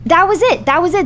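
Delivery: high, exasperated outburst; angry disbelief. Real, not acted. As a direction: burst out in frustrated disbelief. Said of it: "I am so angry".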